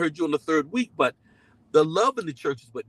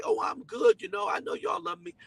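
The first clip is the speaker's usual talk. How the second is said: high, light brush-off, much higher and airy; mock downplaying; 13 semitones higher, 4 dB quieter than usual. Pastor downplaying his congregation's care, high airy brush-off. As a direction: wave it off like it's nothing, pitched way up. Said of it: "I'll downplay it and say".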